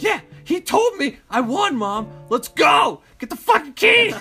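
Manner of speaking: put-on high, loud excited kid yelling; frantic yell at mom to grab the keys; losing it. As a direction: shout it like a kid who can't wait. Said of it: "He's just fucking losing it".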